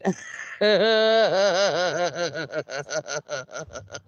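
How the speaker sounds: long breathless wheezing laugh; wheezing laughter. Real, not acted. Cut off by chat, wheezing laugh. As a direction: laugh till the breath runs out. Breaks up mid-name.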